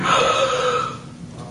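long, breath-only gasp; imitated gasp of sudden realization; overwhelmed gasp. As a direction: act out a stunned intake of breath. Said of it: "She said it was overwhelming".

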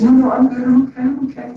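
flustered passenger. Retold or imitated. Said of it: "the lady said".

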